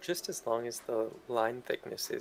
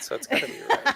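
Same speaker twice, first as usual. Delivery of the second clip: high laughing voice; 13 dB louder, faster than usual. Real, not acted. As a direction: laugh through the words. Joking while laughing.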